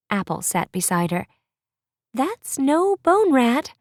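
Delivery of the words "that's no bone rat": awed remark. Acted. Apple voiced, amazed at dragon.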